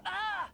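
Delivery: sharp pained gasp, high and loud; pain cry. Acted. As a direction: cry out as pain shoots through the arm. Arm hit by ritual pain.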